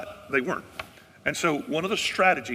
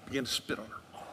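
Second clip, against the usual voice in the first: hushed; 2 semitones lower, 9 dB quieter than usual. Acted. Telling of the spitting.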